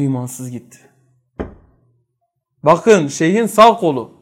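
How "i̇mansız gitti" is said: low, soft, heavy-hearted words; grieving sheikh. Retold or imitated. Weeping sheikh answering his disciples, low and sorrowful. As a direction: let it fall quietly in grief. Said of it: "Şeyh ağlıyor".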